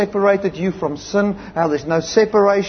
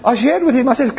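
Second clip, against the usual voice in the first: normal talking voice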